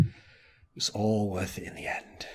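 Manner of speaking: slow, low, whispery echo; mock-solemn whisper, very soft and slow; hushed repeat. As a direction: draw it out in a near whisper. Repeats the line for mock effect.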